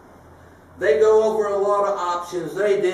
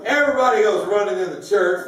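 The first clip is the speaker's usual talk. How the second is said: usual speaking voice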